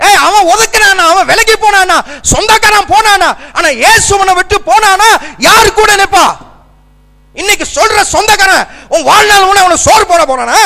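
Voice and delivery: high, loud volley of challenging questions; fiery preaching. Real, not acted. Preaches at full force.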